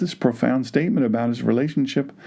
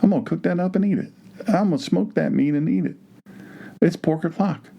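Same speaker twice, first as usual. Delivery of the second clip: quick, higher, eager mock voice of a bacon lover; mock hungry pig-eater; 8 semitones higher, faster than usual. Mocking an imagined eater to his congregation, quick, higher and eager. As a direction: rattle it off like someone eyeing dinner. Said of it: "look at a pig and go".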